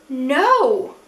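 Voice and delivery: loud, dismayed no; dismayed cry. Real, not acted. Reviewer sees her shadow fall out, loud dismayed cry. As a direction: cry out as if it can't be true.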